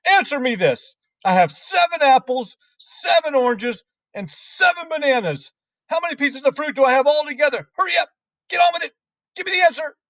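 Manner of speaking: high, loud headmistress interrogation; bullying demand. A narrator's reading. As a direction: fire the question at a pupil, high and impatient. Trunchbull quizzes and hurries Wilfred.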